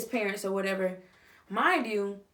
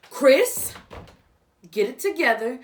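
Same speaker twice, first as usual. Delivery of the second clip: abrupt loud, raised-pitch scolding; sudden outburst; 3 semitones higher, 7 dB louder than usual. Acted. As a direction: flip into a sharp telling-off. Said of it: "went from that to all of that".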